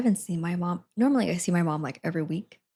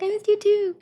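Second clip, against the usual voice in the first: high excited reply; 14 semitones higher, 5 dB louder than usual. Retold or imitated. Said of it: "I was like".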